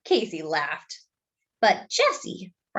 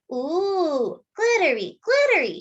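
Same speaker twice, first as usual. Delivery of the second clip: put-on high, cooing toddler voice; little brother marveling, pitched very high; delighted little boy; 9 semitones higher than usual. Toddler brother admires sister's skirt, high and cooing.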